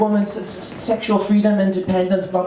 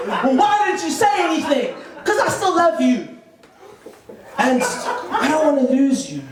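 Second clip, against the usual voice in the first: mock-emotional spouse, pitched up; pleading spouse; 5 semitones higher than usual. Spouse asks about the affair secret, mock-hurt higher pleading. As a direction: lightly act a hurt confession, higher voice. Wife's confession in acted quarrel.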